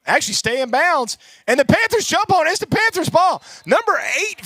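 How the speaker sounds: high, loud burst of surprise; excited call. Real, not acted. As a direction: shout it as the ball is recovered. Surprise onside recovery call.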